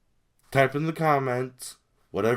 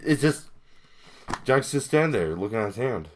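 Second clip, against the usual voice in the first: ordinary talk, nothing marked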